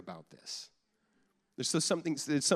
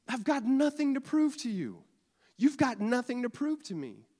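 much higher, ringing voice of freedom; emphatic declaration; 11 semitones higher, 4 dB louder than usual. Acted. Believer declaring to others he has nothing to prove, high. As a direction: declare it, lifted high and sure.

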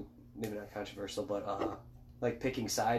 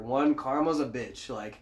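ordinary talk, nothing marked

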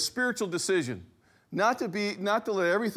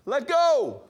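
loud, higher call across the boat; 8 semitones higher, 5 dB louder than usual. Retold or imitated. Boat driver shouting to Hal on the rope, loud call. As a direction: yell the order to let go.

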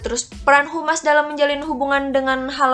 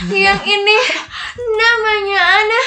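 high, sweet squeaky voice; cute voice; 9 semitones higher, 3 dB louder than usual. Acted. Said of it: "Di balik suara-suara imut ini".

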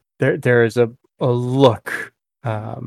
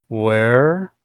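very slow Illithid voice, a little clearer; wet, laboured speech; much slower than usual. Acted. Illithid repeats the question for the captive, slow wet laboured Common. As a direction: draw out each word through a wet mouth. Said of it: "in a wet kind of sound".

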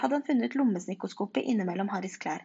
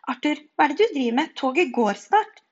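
loud, raised scolding call; urgent calling; 5 semitones higher, 7 dB louder than usual. A narrator's reading. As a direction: hurry your husband along, drawing out the end. Wife calls husband to departing train. (høyt, masende rop)

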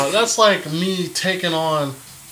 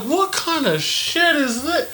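incredulous; 10 semitones higher, slower than usual. Retold or imitated. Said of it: "I'm like".

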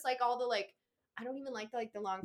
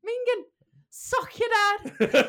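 Irish accent; 13 semitones higher, 10 dB louder, much slower than usual. Shown as a demonstration. Reading Irish slang in accent.

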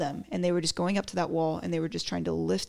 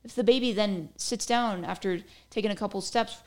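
usual speaking voice

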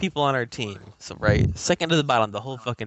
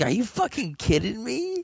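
high, exasperated outburst; squeaky can't-believe-this question; incredulous disbelief; 13 semitones higher than usual. Retold or imitated. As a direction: retell your stunned reaction, pitch leaping up. Retelling his disbelief at teammate.

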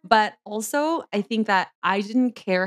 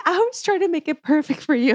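mock whiny sister; 7 semitones higher than usual. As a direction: whine it like a hurt younger sister. Sister's defensive reply quoted.

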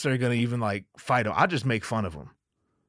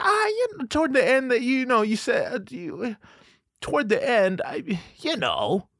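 much higher, hedging, halting wife voice; hesitant wife; 10 semitones higher than usual. Retold or imitated. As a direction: play his wife dodging the answer nervously.

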